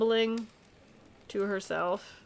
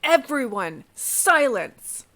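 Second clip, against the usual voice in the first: loud, raised order for silence; commanding shout; 5 semitones higher, 10 dB louder, much slower than usual. Acted. Soldier silencing a screaming group, commanding shout. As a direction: shout them all down with authority.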